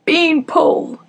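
loud, high happy shout; joyful shout. Acted. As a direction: call her nickname in delight. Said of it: "he shouted as he hugged me".

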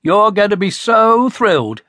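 brimming with excitement; excited build-up. A narrator's reading. Dad building up the big news for Connie, excited.

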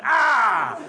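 high, loud cry of craving; loud ecstatic "ah". A narrator's reading. Sausage addict raving about meat salad, loud cry.

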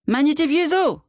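high yelled call; shouting. A narrator's reading. Wife waving her arms outside, calling to husband, high shout. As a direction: hurry him home with a high cry. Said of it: "criait-elle dehors".

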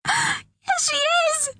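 put-on extremely high, frantic child's cry; shouting. A narrator's reading. Pearl contradicting Jamal about her mum, shouting. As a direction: shriek the contradiction desperately. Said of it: "shouted Pearl".

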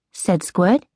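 usual speaking voice

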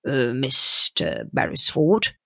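slow, drawn-out query; hesitant businessman. A narrator's reading. Businessman stalls when asked about her partner, hesitant. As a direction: stall over the name, slowly. Whittington hesitates over the name.

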